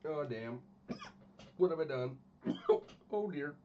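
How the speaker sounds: hiccupping between words; hiccups. Real, not acted. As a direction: talk through hiccups. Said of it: "Oh, I got the hiccups".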